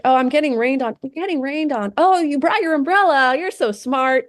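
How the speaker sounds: high gushing assistant praise; high sugary chirpy voice; flattering AI voice. Retold or imitated. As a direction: gush over the user like a sweet helper. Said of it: "And it's like".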